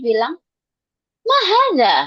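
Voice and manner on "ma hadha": high startled "Ma hadha?", jumping up in pitch; loud, high shocked question; surprised. Shown as a demonstration. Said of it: "agak-agak kaget gitu".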